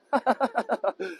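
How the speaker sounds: amused run of ha-ha laughter; hearty laugh. Real, not acted. Guide joking about his Texan tour group, hearty laugh. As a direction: laugh freely at your own remark.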